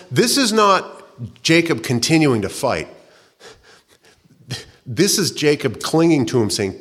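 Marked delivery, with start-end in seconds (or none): none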